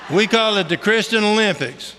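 ordinary talk

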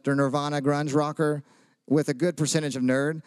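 ordinary delivery

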